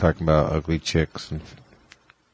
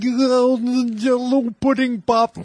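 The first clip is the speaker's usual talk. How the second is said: comedian impression. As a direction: drag it out in an old comic's voice. Bill Cosby special talk.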